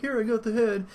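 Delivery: small, high-pitched eager squeak of a voice; piddly little knight. Acted. Knight presenting the head to his leader, high piddly voice. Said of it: "this little piddly knight".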